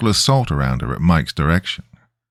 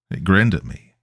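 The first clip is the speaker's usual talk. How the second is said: usual speaking voice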